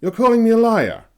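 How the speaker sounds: loud, high furious accusation; angry bark. A narrator's reading. Young hunter confronts Heinrich, angry loud bark. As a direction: bark it at him, rising to a yell. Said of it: "he barked".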